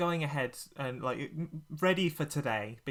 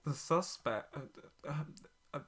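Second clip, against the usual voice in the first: usual speaking voice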